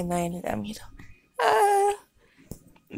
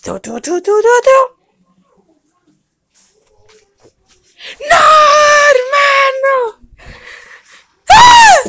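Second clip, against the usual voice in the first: high, loud wailing scream; screaming dog; 11 dB louder than usual. Acted. Dog screams losing the jump, high and loud. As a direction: scream as you fall.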